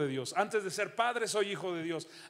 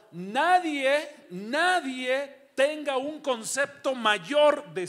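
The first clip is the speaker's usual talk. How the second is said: loud, higher and slow, hammering each word; emphatic scripture quote; 6 semitones higher, 7 dB louder, much slower than usual. Retold or imitated. Pastor quoting the apostle Paul to the congregation, loud and slow. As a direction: proclaim the apostle's warning. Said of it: "El apóstol Pablo dijo".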